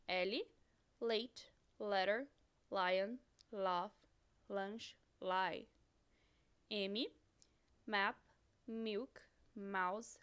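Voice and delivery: each English word said clearly with pauses; teacher reading a vocabulary list in English; English model words. Shown as a demonstration. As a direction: model the English pronunciation for repeating. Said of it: "pode ser silenciosa".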